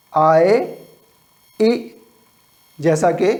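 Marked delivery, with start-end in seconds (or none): 1.6-1.7 ई cut to a quick "ih"; teacher demonstrating short ई; short I sound. Shown as a demonstration. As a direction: keep ई brief, as in big. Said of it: "short sound है".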